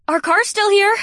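loud, high proud announcement; declaring. Acted. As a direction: call it out for all to hear. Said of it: "Janine declared".

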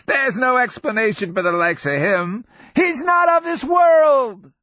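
put-on high old-man holler, building to a shout; cackling madman raising his voice; loud crazed outburst. A narrator's reading. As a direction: cry it out high and loud, amazed. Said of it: "She flinches at the volume of his voice".

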